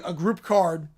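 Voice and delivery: usual speaking voice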